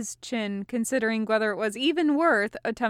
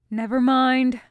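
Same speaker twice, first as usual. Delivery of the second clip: a louder, resigned give-up; resigned "never mind", louder; sighed; 3 semitones higher, 7 dB louder than usual. A narrator's reading. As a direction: wave it off with a sigh. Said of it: "Varric sighed".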